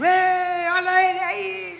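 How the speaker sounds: high, loud cheering call; excited greeting shout. Retold or imitated. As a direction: greet him like the guest of honour. Party crowd greets his arrival.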